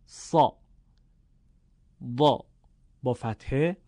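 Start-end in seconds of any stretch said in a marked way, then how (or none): 0.1-2.4 «صا، ضا» with a thick, full short vowel; heavy fatha. Shown as a demonstration. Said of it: "باید به صورت درشت و پرحجم".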